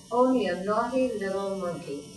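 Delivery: ordinary delivery, nothing marked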